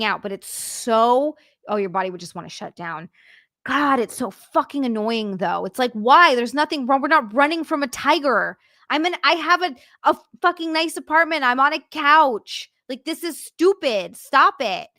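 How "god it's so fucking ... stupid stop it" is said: exasperated rant, pitched up; frustrated. Real, not acted. Streamer venting about needless anxiety, frustrated. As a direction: protest the senselessness of it, voice rising. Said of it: "I know I'm getting frustrated".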